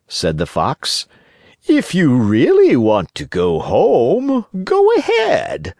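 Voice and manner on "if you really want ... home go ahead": high, slow, sly fox; sly fox warning. Acted. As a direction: warn him slowly, sweetly threatening. Fox warns Pinocchio.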